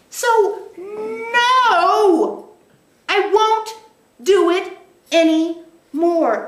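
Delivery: high, loud, final no; emphatic refusal. Retold or imitated. Speaker voicing a vow to stop old patterns, high and loud. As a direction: plant your feet and refuse.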